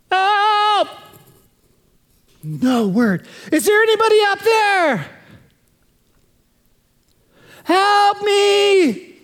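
high pleading shout for help; desperate cry for help. Acted. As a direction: call up the cliff, frantic. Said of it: "He goes".

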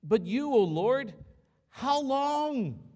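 high, loud anguished cry to God; anguished plea. Retold or imitated. David crying out to the Lord in anguish, loud pleading. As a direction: lift it up like a desperate psalm. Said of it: "he goes on to say this".

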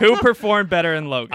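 high, boisterous challenge to a friend; loud challenge. Real, not acted. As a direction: press the question loudly through laughter. Repeats the challenge over laughter.

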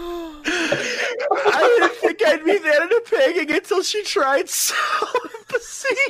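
slow, high, tearful giggly reading; crying with laughter. Real, not acted. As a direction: push the card's words out through laughter.

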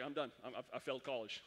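hammed-up giving up; mock defeat. Freshman after failing his test, comically defeated.

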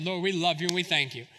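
a hint of a reverent prayer voice. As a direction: begin to pray, turning to God. Opens a prayer.

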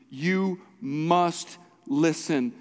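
"You must listen" very slow, each word separate; strong emphasis. Real, not acted. Pastor exhorting church to hear, solemn emphasis on "must". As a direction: weigh down "must" with a long, deliberate stress. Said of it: "I can't place a stronger emphasis on this".

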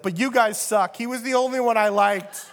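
a lightly voiced pouting dad; petulant, whiny complaint to his sons; sulking father.

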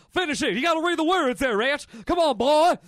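mock urgent Elvis; urgent urging. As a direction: spur him on to finish. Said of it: "Elvis is like".

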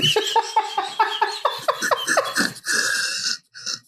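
squealing high-pitched laugh; helpless high laughter. Real, not acted. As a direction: burst into a high giggle. Cracks up at own pitch.

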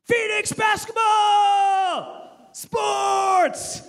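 full-throated cheering shout, very high and loud; hammed-up sports cheer; screaming. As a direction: whip up a crowd with a wild yell. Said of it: "I start screaming".

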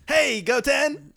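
high, loud cartoon call; Krillin. Shown as a demonstration. Mimicking co-host's greeting, high drawn-out cartoon voice. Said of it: "Oh, that sounded like Krillin".

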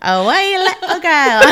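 a slow, high attempt at a Scottish accent; a failed Scottish accent, drawn out; Scottish accent try. Sister tries the Scottish accent, slow and high. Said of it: "I wish I could do the Scottish accent".

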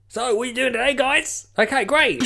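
high, loud mock Australian radio host. Radio DJ greeting guests on air, mock Australian accent. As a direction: put on a chirpy Australian presenter, pitched right up. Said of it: "I don't know why he's Australian".

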